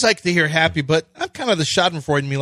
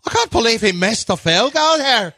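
Irish commentator; 10 semitones higher than usual. Pretend. Mock Irish announcer lamenting a missed field goal. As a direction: rant about the miss in Irish brogue. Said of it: "Irish, Irish".

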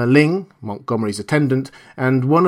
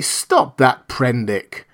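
ordinary delivery

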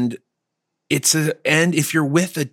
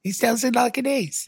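character voice; 12 semitones higher than usual. Acted. Put-on character voice.

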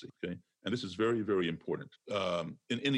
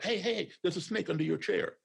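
high, startled warning shout; sudden shout; 9 semitones higher than usual. Retold or imitated. Speaker startling a classroom of children, sudden high shout. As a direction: yell it out suddenly to make the kids jump. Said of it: "I shout".

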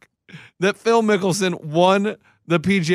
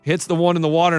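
normal talking voice

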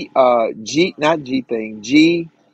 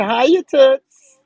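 playful high greeting; 9 semitones higher, 4 dB louder, much slower than usual. Acted.

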